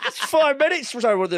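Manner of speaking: high, loud, through real laughter; can barely get it out for laughing. Real, not acted. Still laughing at the story.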